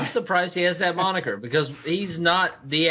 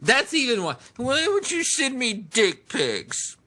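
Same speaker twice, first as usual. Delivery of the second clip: whiny voice; 2 semitones higher, slower than usual. Pretend. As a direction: keep the nasal whine going. Whiny voice game continues.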